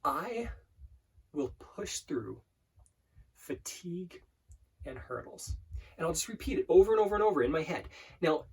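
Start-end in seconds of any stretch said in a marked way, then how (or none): 0.0-5.6 very slow, hushed, with long pauses; slow mantra. Shown as a demonstration. Coach slowing an affirmation right down, slow mantra. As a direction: breathe out each phrase softly, leaving space. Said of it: "I'm going quite fast right now".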